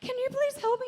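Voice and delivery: high-pitched mock plea, half laughing; retold plea for help. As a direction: call out pleading to someone far away. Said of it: "and I just scream".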